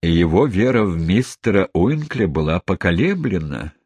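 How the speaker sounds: usual speaking voice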